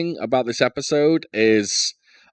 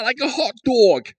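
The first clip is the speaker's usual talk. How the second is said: much higher hammed-up New Yorker; New York accent; 12 semitones higher than usual. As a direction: keep the cartoon New York voice going. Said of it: "Pitch perfect".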